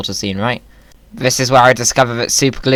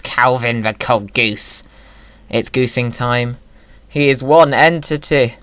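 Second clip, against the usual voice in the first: ordinary talk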